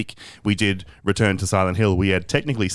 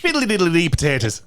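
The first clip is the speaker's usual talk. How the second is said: higher-pitched, hammed-up Irish accent; mock Irish accent; 6 semitones higher than usual. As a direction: caricature an Irish lilt. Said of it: "don't make me Irish".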